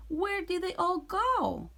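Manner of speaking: high witch calling out; puzzled witch call. Acted. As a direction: call out baffled. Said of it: "Gretch shouted to a duck".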